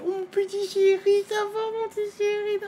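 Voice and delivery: very high, slow cooing; baby talk. Retold or imitated. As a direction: coo like to a pet. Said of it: "tu me dis". (voix bêtifiante)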